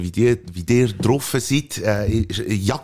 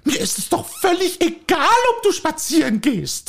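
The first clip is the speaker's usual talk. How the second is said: a higher, fussy housewife voice pestering her husband; nagging wife; 3 dB louder, slower than usual. Acted. Wife nagging her husband to go for a walk, fussy. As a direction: play the nagging wife in a comic sketch. Acted dialogue sketch, wife role.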